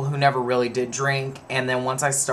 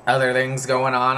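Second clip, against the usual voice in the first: ordinary delivery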